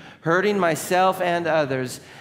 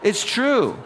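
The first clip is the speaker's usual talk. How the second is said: ordinary delivery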